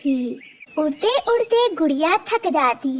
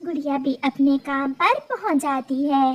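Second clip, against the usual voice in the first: ordinary delivery, nothing marked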